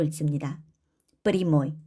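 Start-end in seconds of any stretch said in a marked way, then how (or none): 1.2-1.8 프리모이 with ya said as i; unstressed ya as i. Shown as a demonstration. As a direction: say 프리모이, stress on the o. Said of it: "강세 앞에 야는 이로".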